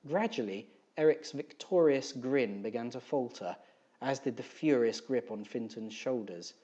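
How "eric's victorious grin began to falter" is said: plain reading voice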